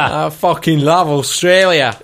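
slow, broad, hammed-up Australian; bellyful of fake Aussie gusto; mock Australian accent. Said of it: "I love Australian accents".

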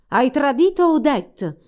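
slow, loud, high villain's accusation; wicked shout. Acted. Evil wizard accusing the prince, slow furious shout. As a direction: bellow it out like a gloating sorcerer. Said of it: "gridò il perfido mago".

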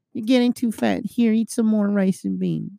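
high nagging grandma; grandmother voice. Retold or imitated. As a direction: scold then feed like grandma. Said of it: "grandmother quote".

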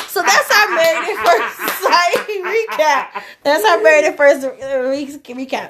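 slow, laughing words that keep breaking up; can barely get the words out for laughing; laughing fit. Real, not acted. Host laughing while closing the recap segment.

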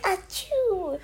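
soft imitated sneeze; a high little fake achoo; mock sneeze. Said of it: "he's like".

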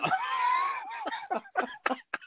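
high, shrieking burst of laughter; laughing hard; big laugh. Real, not acted. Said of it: "That is great".

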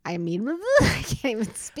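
laughing too hard to get it out; giggling so much she can't finish the sentence. Real, not acted. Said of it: "performing for a camera".

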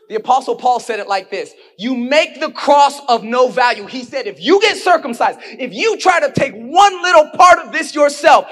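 loud, rising preacher's delivery; preaching at full force. Acted. Pastor pressing the church hard, booming preaching.

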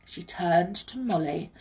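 usual speaking voice

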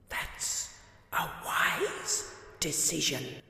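slow eerie whisper; slow, breathy Death voice. Acted. Death accepting the godfather role, slow and whispered.

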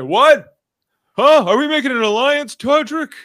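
oblivious, booming high-pitched imitation; mock shouting, far too loud for secrecy; [name]. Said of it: "Lower your voice, [name]".

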